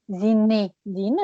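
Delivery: Zinedine drawn out with a buzzing z; slow it down on Zinedine; slow model. Shown as a demonstration. Teacher modelling the z for the student. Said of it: "mais c'est pas grave".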